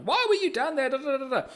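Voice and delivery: high, mock frantic yelling; mock panicked yell. Mum yelling at her injured daughter, mock frantic. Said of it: "yelling and screaming and freaking out".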